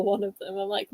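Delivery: usual speaking voice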